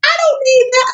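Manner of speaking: incredulous "I don't need that"; indignant dismissal. Real, not acted. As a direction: throw the gift back at him. Rejecting the ex's gift.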